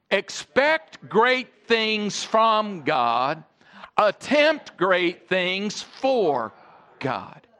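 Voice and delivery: slow, weighty saying for all to repeat; slow call and response. Shown as a demonstration. Pastor leading the congregation in the saying, slow and weighty. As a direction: lead the church in saying it together, slowly. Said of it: "Say it with me".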